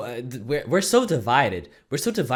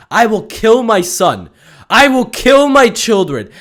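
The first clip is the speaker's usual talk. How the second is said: louder, slow, deadly serious; mock fanatic vow; 9 semitones higher, 9 dB louder, slower than usual. Devoted fan vowing to viewers, slow and louder. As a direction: vow it slowly like a zealot.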